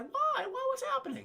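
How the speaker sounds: high squeaky panic; alarmed squeak. Retold or imitated. Dad panicking at Buttercup's farewell, high squeak. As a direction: squeal it like a startled old man.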